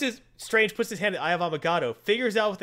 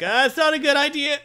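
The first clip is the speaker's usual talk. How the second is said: high, louder put-on voice; a nervous high sidekick voice; pitched-up warning; hesitant warning; 10 semitones higher, 6 dB louder than usual. Red Cat warns hesitantly.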